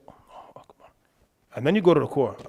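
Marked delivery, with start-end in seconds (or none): 0.1-0.9 Allahu Akbar breathed softly while standing; whispered takbir. Shown as a demonstration. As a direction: whisper the takbir just to yourself. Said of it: "you stand in line and you say".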